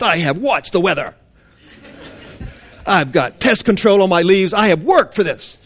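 mock the proud worker demanding credit; indignant legalist. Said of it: "Legalists are offended by grace".

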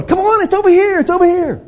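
high, calling to the crowd; excited calling. Acted. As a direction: wave them over.

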